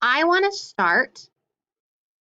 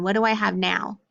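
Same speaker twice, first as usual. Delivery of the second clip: ordinary talk, nothing marked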